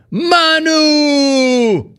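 high, long sustained yell of a name. Acted. Host voicing Chuck's message for Manu, yelling. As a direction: holler it high like a fan and hold the last vowel. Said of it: "Chuck yelling".

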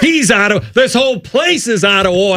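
high-pitched mock courtroom outburst; mock outrage. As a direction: protest with hammed-up indignation. Mock courtroom outburst over the accent.

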